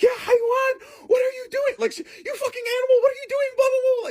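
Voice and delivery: high-pitched scolding grandma impression; imitated shrieky telling-off; mock yelling. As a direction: yell like an outraged grandma, way up high. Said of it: "like, yelling at me".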